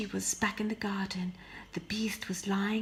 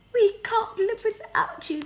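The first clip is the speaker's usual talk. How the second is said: much higher, louder fake wailing; howling sisters; 13 semitones higher, 9 dB louder than usual. Sisters pleading with Beauty, fake wailing high and loud. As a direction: ham up the howl. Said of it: "they howled".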